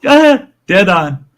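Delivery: high, loud, laughing confirmation; laughing that she nailed the guess; laughing yes. Real, not acted. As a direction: crow it out, delighted she guessed right. Guest guesses cooking channel right.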